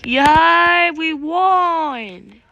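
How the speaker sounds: loud, high, drawn-out cheer; delighted cheer. Real, not acted. Player cheering as the round is won, excited whoop. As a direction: celebrate the win out loud.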